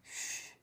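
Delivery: soft secretive shushing; hushing shh. Pretend. Fan hushing Johnny about the pug, soft shh. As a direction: hush them to keep the secret.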